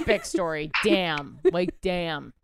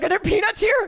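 high, louder panicked squeak through laughter; mock panic; 13 semitones higher, 5 dB louder than usual. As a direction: play up the allergy panic, laughing. Mocking friend's allergic panic.

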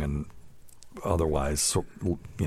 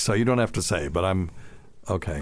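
ordinary talk

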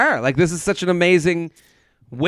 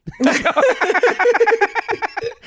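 high laugh. Real, not acted. Laughing at her own coffee shop story. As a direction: burst of high-pitched laughter.

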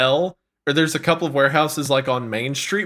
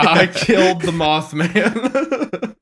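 mock confession; 3 semitones higher, much slower than usual.

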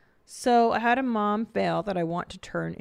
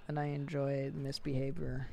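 normal talking voice

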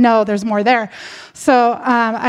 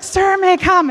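pitched up, pressing plea; urgent asking; 6 semitones higher than usual. Retold or imitated. Partner begs permission again, higher and urgent. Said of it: "says it with more urgency".

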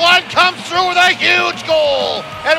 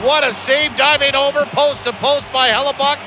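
rapid hockey play-by-play; 11 semitones higher than usual. Acted. Played clips of radio calls.

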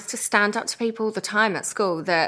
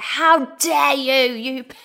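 drawn-out, higher-pitched "how dare you", dropping away; retold outraged outburst; 4 semitones higher, slower than usual. Mother retelling her row with a plane passenger, mock outraged outburst. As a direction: re-enact indignation, slowly stretched. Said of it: "I just went into one".

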